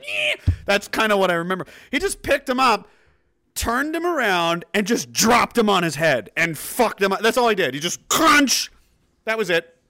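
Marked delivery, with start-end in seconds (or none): 0.1-0.4 tiny high-pitched squeak; squealing beetle. Retold or imitated. Imitating a flailing beetle held by its shell, squeak. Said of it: "you're holding it by the shell". 8.1-8.7 loud, high crunch; impact imitation. Telling how he dropped him on his head, loud crunch.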